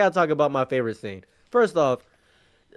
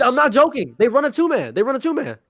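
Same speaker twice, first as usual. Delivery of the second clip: high, loud insistence; a raised, earnest insistence; insistent; 9 semitones higher, 4 dB louder than usual. Real, not acted. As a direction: say it high and loud, pleading to be believed. Insisting to disbelieving friends.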